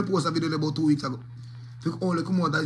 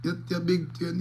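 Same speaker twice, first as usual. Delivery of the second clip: normal talking voice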